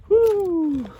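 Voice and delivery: high, loud happy whoop; joyful woo at the catch; excited whoop. Real, not acted. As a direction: whoop with delight at the find. Excited shout while foraging.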